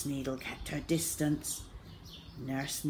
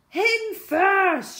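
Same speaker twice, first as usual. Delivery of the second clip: high, loud panicked shriek; 9 dB louder than usual. Acted. Henry pushing his brother ahead for the injection, shrieking. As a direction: shriek it out at full volume. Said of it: "shrieked Henry".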